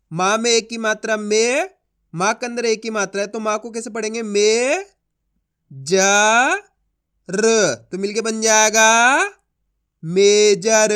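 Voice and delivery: slow and loud, letter by letter; slow spelling-out demo. Shown as a demonstration. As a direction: stretch each sound, then land loud on major. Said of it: "देखो किस तरीके से इनको आपस में मिला के पढ़ा जाता है".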